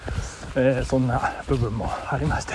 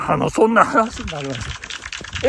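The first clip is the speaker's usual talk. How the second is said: ordinary talk, nothing marked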